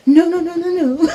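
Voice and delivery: high, loud frantic no's; fleeing with suitcases, frantic no; panicked refusal. Retold or imitated. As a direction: wave them off in mock panic. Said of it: "with these two suitcases just tagging".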